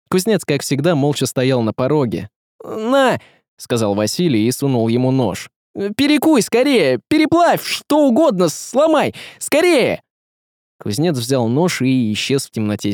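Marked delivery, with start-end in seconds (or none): 2.6-3.2 urgent. A narrator's reading. Hands knife over in desperate haste. 6.0-10.0 frantic urging. A narrator's reading. Desperately begs smith to destroy knife.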